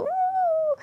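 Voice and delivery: high squeezed ooh; voice closing up. Shown as a demonstration. Guest demonstrates how nerves closed her voice, high tight ooh. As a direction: show the voice choking up high. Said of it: "It made my voice go".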